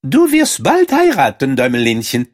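sehr hohe, laute Mäusestimme; Feldmaus. A narrator's reading. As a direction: bestimmende kleine Feldmaus. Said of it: "sagte die Feldmaus". (hohe, bestimmte Mäusestimme)

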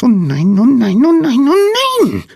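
very high, loud squeaked lament; squeaky wail. A narrator's reading. Small someone wailing in the dark bedroom, piercing squeak. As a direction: squeal it, climbing to a peak. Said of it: "piepste jemand".

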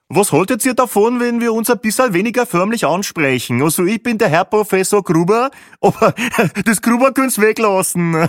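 high, loud hearty Austrian joker; Peppi's voice. A narrator's reading. Peppi proposes first names, jolly and loud. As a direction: laugh into the punchline.

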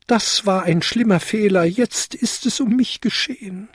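high lament of a doomed little kobold; wailing. A narrator's reading. Kobold sure he is done for, wailing. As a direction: lament it high, as if all is lost. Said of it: "jammerte er".

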